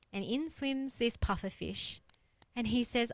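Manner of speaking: usual speaking voice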